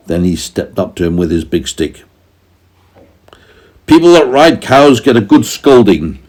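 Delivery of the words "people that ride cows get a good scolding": very angry farmer. A narrator's reading. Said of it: "he said".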